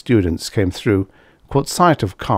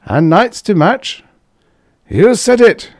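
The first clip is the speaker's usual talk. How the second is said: put-on higher, louder tipsy young man; 13 semitones higher, 6 dB louder than usual. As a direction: toast it loudly, a bit drunk. Karsh toasting, drunk.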